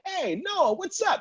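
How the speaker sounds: high bright hello; cheerful greeting. Retold or imitated. Greeting the DJ across the party, upbeat. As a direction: greet an old friend. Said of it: "I'm like".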